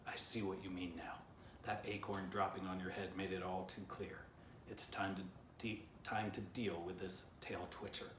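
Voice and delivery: ordinary delivery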